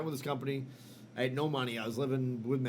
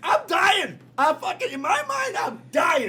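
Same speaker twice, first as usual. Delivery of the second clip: much higher, louder incredulous yell; outraged shout; 11 dB louder than usual. Retold or imitated. Patient yells at the doctor who blamed his belt, outraged. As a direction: yell it like you can't believe the diagnosis.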